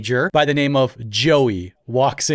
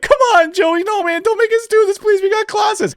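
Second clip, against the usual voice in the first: much higher squeaky begging, half shouted; whiny pleading kids; 3 dB louder than usual. Retold or imitated. Grade nines begging Joey in the showers, squeaky mock plea. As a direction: plead like panicked freshmen. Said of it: "And they're like".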